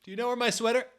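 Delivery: very high, mocking squeak on sweater; squeaky mock voice. Shown as a demonstration. Mocking the author asking Raven about the sweater. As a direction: squeak the question in a silly high voice. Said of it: "Hey, Raven".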